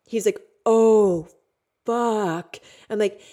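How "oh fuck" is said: loud, higher curse of dawning alarm; heavy, re-enacted "uh-oh" reaction; imitated alarmed partner. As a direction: act out the partner's dismay. Acting out partner's alarmed realisation.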